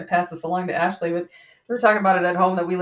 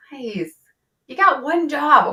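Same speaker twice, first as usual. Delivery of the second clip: exasperated scolding; 8 semitones higher, 6 dB louder, slower than usual. Retold or imitated. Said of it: "So I'm like".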